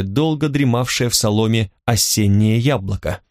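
ordinary delivery

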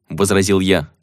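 normal talking voice

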